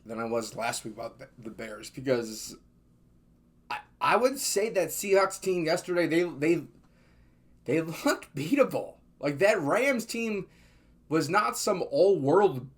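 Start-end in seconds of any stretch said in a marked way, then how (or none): none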